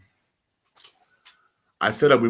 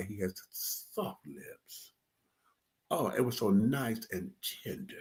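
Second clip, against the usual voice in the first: dreamy softness; 12 dB quieter than usual. Real, not acted. Dreamy memory of kiss.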